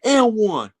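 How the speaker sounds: high, loud and drawn out, taunting; mock trash-talk yell. Player taunting a defender after a score, loud drawn-out yell. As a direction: stretch "and one" out like a taunt after a bucket. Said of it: "if someone goes".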